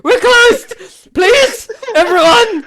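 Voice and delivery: high, loud pleading order; frantic shopkeeper. Acted. Shopkeeper frantically clearing the shop, high and loud. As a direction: shoo everyone out. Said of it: "She's like".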